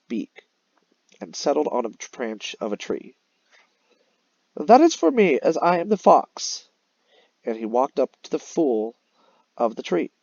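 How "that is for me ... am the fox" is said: high, loud greedy fox claiming the cheese; sly fox voice. Acted. Teacher reading the fox to her class, high and smug.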